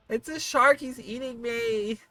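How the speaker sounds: high squeaky mock panic at the shark; playful squeal. Streamer pretending the shark hat eats her, squealing. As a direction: squeak it like the hat bites you.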